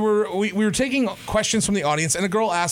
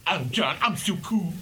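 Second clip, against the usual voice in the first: John; 2 semitones lower, 4 dB quieter than usual. Retold or imitated. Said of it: "John is just like".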